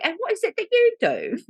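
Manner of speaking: high-pitched mock British woman; imitated English woman's sing-song question; British accent. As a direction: ask it in a put-on, high British voice. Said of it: "not allowed to do British accent".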